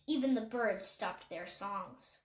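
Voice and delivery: usual speaking voice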